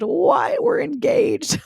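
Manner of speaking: high-pitched squeal; excited disbelief. Retold or imitated. Woman re-enacting seeing her engagement ring, squealing in disbelief.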